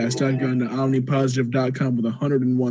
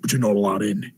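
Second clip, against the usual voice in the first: put-on gruff Australian accent; 4 semitones lower than usual. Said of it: "in a gruff Australian accent".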